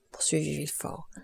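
plain reading voice

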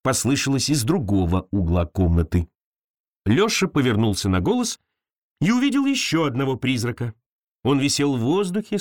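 ordinary delivery

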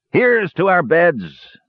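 put-on dwarf toasting the drunk brothers, slow and sinister; gruff, sinister dwarf. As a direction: toast with hidden triumph, slowly. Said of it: "a sinister note in the gruff voice".

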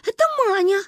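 put-on high, urgent children's blame-shifting; hasty protest. A narrator's reading. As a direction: blurt out who did it. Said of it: "зачастили мы с Кариной".

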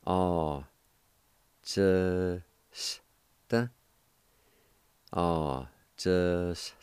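"Ah" and "just" said apart, with a break between; split into syllables. Shown as a demonstration. Teacher splitting the word for students, syllables apart. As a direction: separate the two syllables for the class. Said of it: "It's pronounced like this".

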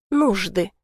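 plain reading voice

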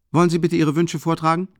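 high, loud and boisterous, a big clumsy student; hearty booming Rasumichin. Acted. Rasumichin ducking into the tiny room, loud and hearty. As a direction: fill the cramped room with your voice.

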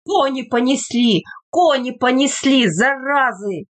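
ordinary delivery

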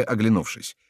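ordinary delivery

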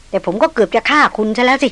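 ordinary delivery

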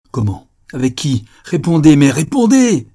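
questions building to a high, loud shout; frantic, enraged interrogation; furious demand. Acted. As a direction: escalate into a raging order to answer. Enraged father demands an answer.

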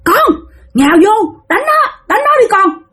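shrill high command to attack; ghost urging her child. Retold or imitated. Ghost mother ordering her child to attack, shrill. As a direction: egg the kid on to strike, very high. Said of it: "Cái bả mới kêu đứa con bả".

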